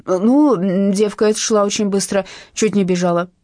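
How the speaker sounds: plain reading voice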